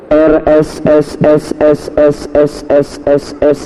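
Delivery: drawn-out hissy S, S, S; hissing S sounds. Shown as a demonstration. Drawing out S, S, S to tease how many S the address has. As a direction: hiss the S sounds one after another. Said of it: "S-nya banyak lah".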